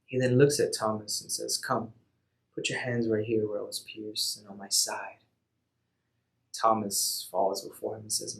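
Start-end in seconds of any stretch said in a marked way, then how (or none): 1.6-5.2 softer, tender and calm; gentle invitation. Acted. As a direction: coax him close, gently. Jesus gently invites Thomas.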